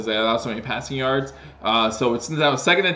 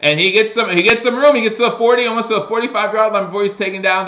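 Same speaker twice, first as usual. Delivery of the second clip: rising excitement, louder and higher; excited play-by-play; 6 semitones higher, 5 dB louder, faster than usual. Real, not acted. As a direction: call the big return with building energy. Live kick return call.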